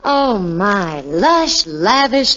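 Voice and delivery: ordinary talk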